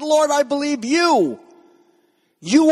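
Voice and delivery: high-pitched declaration of belief; fervent confession. Acted. Martha answers Jesus with her confession of faith, fervent.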